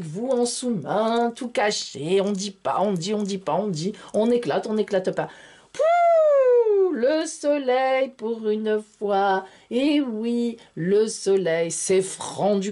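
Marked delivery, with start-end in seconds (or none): 5.7-6.9 a loud high burst of joy; delighted puff. Real, not acted. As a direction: blow out a delighted pouh. Astrologer delighted by the Sun card. (souffle ravi, aigu et fort)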